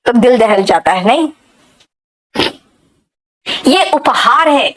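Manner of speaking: ordinary delivery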